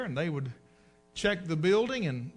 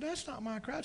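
put-on old preacher's voice; 8 semitones higher, 7 dB quieter than usual. Preacher voiced, high quiet.

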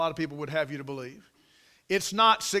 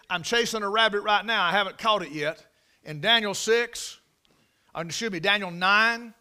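normal talking voice

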